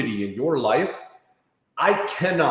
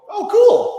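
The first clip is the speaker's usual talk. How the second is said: casual brush-off; 9 dB louder than usual. Retold or imitated. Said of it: "Ninety percent of people just go".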